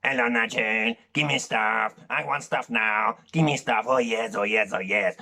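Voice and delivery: put-on purring Puss in Boots Spanish accent; Antonio Banderas impression. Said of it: "it's like Antonio Banderas out of the Shrek films".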